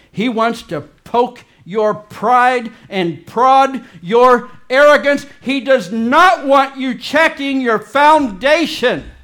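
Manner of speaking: loud, slow, rising preacher's fire; fiery preaching. Real, not acted. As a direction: hammer each word home from the pulpit. Preacher builds to fiery climax.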